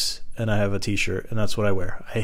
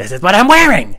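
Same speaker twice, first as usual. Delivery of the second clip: loud, high indignant yell; hammed-up furious outburst; pissed off; 8 semitones higher, 9 dB louder than usual. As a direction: shout back in stubborn protest. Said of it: "I was so pissed off".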